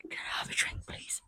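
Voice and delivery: very faint whispered request; barely audible, all breath; hoarse pony whisper. Acted. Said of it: "I'm just a little hoarse".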